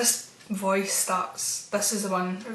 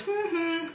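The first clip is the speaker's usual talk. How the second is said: high, soft two-note hum; hummed call; 8 semitones higher, 6 dB quieter than usual. Retold or imitated. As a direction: imitate the girl's hum, high and light. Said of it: "after she does".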